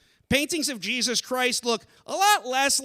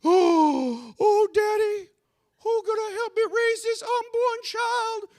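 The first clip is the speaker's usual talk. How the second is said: very high mock-girlish falsetto; distressed daughter voice; 11 semitones higher, slower than usual. Daughter asking Daddy for help in a skit, mock-distressed. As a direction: wail it high like a melodrama damsel.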